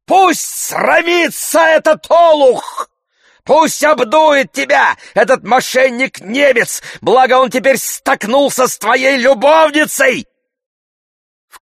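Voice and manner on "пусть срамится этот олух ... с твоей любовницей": loud, high-pitched furious rant; yelling. A narrator's reading. Tarantyev shaming Oblomov before passers-by, yelling. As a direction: yell abuse for everyone to hear. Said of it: "вопил Тарантьев".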